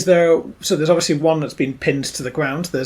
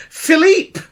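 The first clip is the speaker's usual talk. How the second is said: high, loud shriek of a name; hammed-up scream of alarm; alarmed cry; 13 semitones higher, 7 dB louder than usual. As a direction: cry out as he's attacked. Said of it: "the red-headed woman go".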